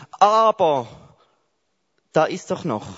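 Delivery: high, loud, eager interruption; mock objection. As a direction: jump in like someone who has to defend God. (laut und hoch, eifriger Einwand)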